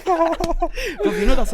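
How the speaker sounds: high, loud, slow through laughter; laughing. Real, not acted. Man still laughing after friend's Morocco story. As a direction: still laughing as you speak.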